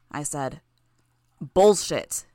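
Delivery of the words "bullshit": loud, outraged shout. Acted. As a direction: bark it out in disbelief. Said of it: "Triumph shouted".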